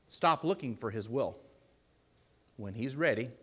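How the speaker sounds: normal talking voice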